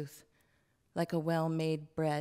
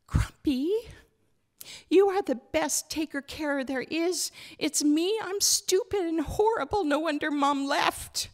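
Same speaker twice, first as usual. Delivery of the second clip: put-on much higher, louder little girl voice in distress; a girl blaming herself to grandpa, near tears; upset child Hazel; 11 semitones higher, 6 dB louder than usual.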